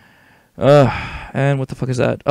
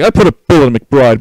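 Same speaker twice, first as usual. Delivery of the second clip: much louder mock re-enactment; hammed-up boast; 12 dB louder than usual. Player re-enacting the shooting, loud hammed-up boast.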